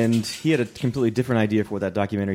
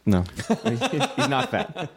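laughing through the words; slow laughing denial; 12 semitones higher, much slower than usual. Real, not acted. Laughs while denying.

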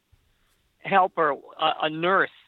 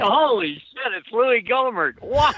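higher, drawn-out stoned wonder; mock amazement; 6 semitones higher, slower than usual. Doped-up patient watching the hearings, mock amazement. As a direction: play doped-up awe, stretching the words.

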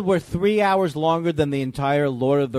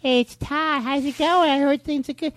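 pestering caller voice; 10 semitones higher than usual. Retold or imitated. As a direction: pester him like a needy friend. Imitating Todd's pestering calls.

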